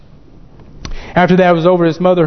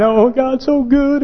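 mock-crying, high drawn-out testimony; high, slow, weepy imitation; tearful testimony; 7 semitones higher, slower than usual. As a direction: imitate a man crying out in praise. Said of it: "Brother Disney, crying".

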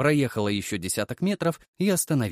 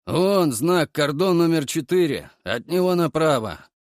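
hoarse bass; 5 semitones higher, 5 dB louder than usual. A narrator's reading. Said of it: "пробасил хриплый голос".

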